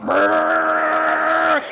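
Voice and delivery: loud rolling brrr of a diesel truck; imitating husband's diesel truck; truck engine rumble.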